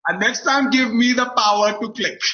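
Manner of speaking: put-on joking Indian-accented demand. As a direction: put on a mock Indian accent, loud and high. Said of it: "I love doing accents".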